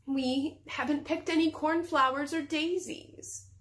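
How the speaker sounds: put-on high, slow, girlish-innocent excuse; wide-eyed innocence. A narrator's reading. Princess wanting to pick flowers, wide-eyed innocent. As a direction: plead it slowly with feigned innocence. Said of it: "opening her eyes very wide".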